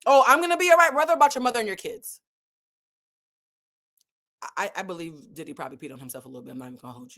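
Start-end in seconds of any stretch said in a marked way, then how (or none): 0.0-2.2 high, louder, rushed panicked reply; hammed-up frightened whimper; mock panic. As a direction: squeak it out like a scared man. [name]'s panicked reply mocked.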